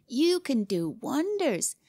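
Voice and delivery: high, slow, jeering praise; mock laughing. A narrator's reading. Boy scoffs at his rival's boasts, mocking amusement. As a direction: draw out the sarcastic compliment. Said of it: "he said, laughing".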